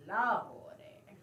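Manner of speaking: breathy whispered Lordy; whispered aside. Acted. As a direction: sigh it under your breath. Old woman sighing aside.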